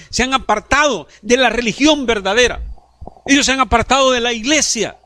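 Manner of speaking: high, loud pulpit preaching; full-force sermon voice; fiery preaching. Acted. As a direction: preach it out to the whole church.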